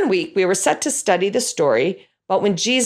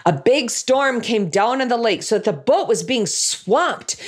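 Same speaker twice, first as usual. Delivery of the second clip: normal talking voice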